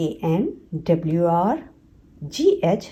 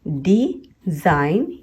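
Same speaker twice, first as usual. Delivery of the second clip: Design very slowly as डि, pause, जाइन; two drawn-out beats in design; split into syllables; much slower than usual. Shown as a demonstration. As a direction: break design after डि. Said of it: "ये भी दो syllable word है".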